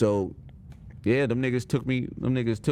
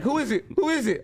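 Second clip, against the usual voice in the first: much higher, anxious mom asking who it is; mom at the door; 14 semitones higher than usual. Retold or imitated. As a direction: play his mom calling out, high and worried.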